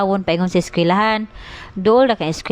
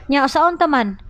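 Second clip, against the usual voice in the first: ordinary talk, nothing marked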